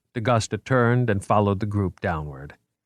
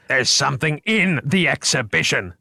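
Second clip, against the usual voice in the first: raised urgent warning; yelled; 6 semitones higher than usual. A narrator's reading. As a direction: warn them sharply. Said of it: "D'Agusta yelled".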